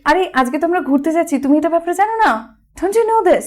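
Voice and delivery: high, bright and a bit quick; excited. Shown as a demonstration. Teacher telling a friend about today's trip, excited and high. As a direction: burst out with the news of today's outing. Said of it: "আবার excited হয়ে বলতে পারি".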